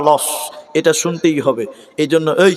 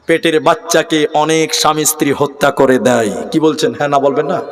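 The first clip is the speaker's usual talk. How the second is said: normal talking voice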